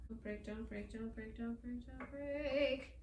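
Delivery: very soft repeated plea, creeping up in pitch; hushed nervous pleading. Real, not acted. Baker moving fragile dough, hushed nervous chant. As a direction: plead under your breath.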